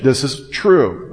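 TRUE drawn out slowly and firmly; slow down and stress "true"; emphatic. Retold or imitated. Preacher voicing Paul vouching for his words, emphatic. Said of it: "emphatically stating with emphatic language".

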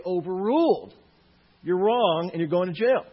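loud, firm verdict; stern judge ruling. Acted. Imagined judge rejects the pedophile's plea, loud and stern. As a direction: bang the gavel and declare it loudly. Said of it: "No, he would say".

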